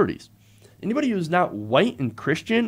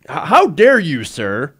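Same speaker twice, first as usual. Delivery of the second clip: mock indignation; 7 dB louder, slower than usual. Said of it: "That's the guy saying".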